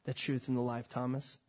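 Jesus answering Thomas gently and low; calm Jesus. Acted. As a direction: say his name tenderly at the end. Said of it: "And Jesus said".

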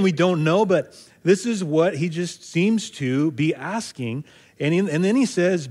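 ordinary delivery

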